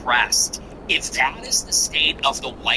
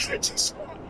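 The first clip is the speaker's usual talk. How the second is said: mock crying; 9 dB quieter than usual. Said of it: "he's literally crying".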